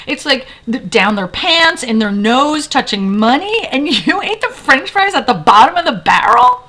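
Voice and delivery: loud, high mock outrage; imitated disgusted rant. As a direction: pile up the gross details like an appalled friend. Friend's disgusted rant continues.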